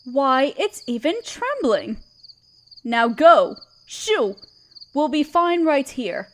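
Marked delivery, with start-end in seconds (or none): 2.8-4.4 commanding dismissal. Acted. Queen dismisses the guard.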